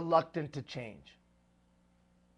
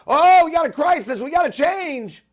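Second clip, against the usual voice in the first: acted panicked shout, much higher and louder; alarmed outcry; 11 semitones higher, 12 dB louder than usual. Somebody at a meeting announcing a crisis, alarmed outcry. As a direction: cry out like someone announcing a crisis. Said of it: "somebody comes along and says".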